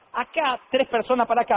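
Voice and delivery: ordinary talk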